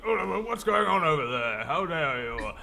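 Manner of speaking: lightly voiced huffy elder; indignant old man. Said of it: "the old man goes".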